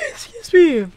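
loud, high squeaky yawn. Real, not acted. Player excusing a yawn mid-video, high yawning voice. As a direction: talk through the tail of a big yawn. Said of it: "I yawn a lot in these videos".

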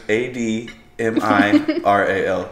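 each letter spelled out slowly. Retold or imitated. Spelling the word for the caller, slow and deliberate. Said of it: "She goes".

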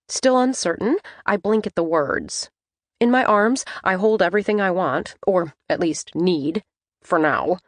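usual speaking voice

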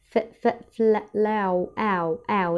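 flour split into ف، فل، لاو, with the آو drawn out; syllable by syllable. Shown as a demonstration. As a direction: stretch the آو in flour. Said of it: "الآو هنا دي اللي هي ال O". (تقطيع flour ومد الآو)

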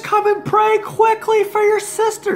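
high-pitched frantic plea; panicked mother. Retold or imitated. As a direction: imitate mom calling out in a panic. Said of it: "everyone's all in panic".

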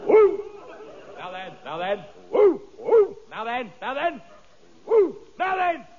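whoop, then try to settle him up high; restraining the dog. Acted.

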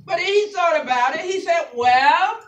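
loud, chanted, half-sung sermon climax, drawn out slow; sing-preach the close, stretching the words; whooping preacher. Acted. Pastor whooping to church over organ, loud and sung.

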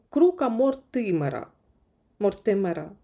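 ordinary delivery

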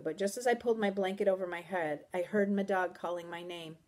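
ordinary delivery

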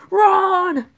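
high, urgent cry to flee; urgent shout. Acted. Dad urging his son to flee the store, high urgent yell. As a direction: yell one drawn command.

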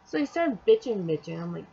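ordinary delivery

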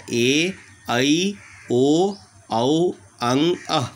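bright, carrying vowel recitation; reciting vowels. Shown as a demonstration. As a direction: call out the vowels to the class. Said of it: "क्या क्या है?".

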